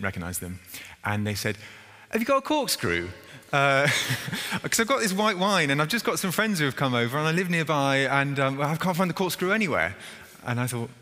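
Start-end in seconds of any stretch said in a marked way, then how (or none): 2.1-9.9 high, chatty imitated visitor; imitating a neighbour. Woman at the vicarage door asking for a corkscrew, high and breezy. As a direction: mimic a breezy stranger at the door.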